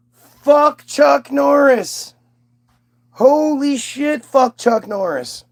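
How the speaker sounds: high, slow exclaiming; excited outburst. Acted. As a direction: shout it out high and drawn. Mock exclamation at a chat name.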